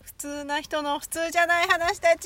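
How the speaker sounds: slow announcer read; title call. Acted.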